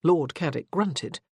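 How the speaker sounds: ordinary delivery, nothing marked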